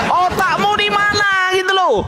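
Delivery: high shouted taunt; jeering shout at the opponent in a livestream debate; mocking shout. Real, not acted. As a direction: yell the insult up high.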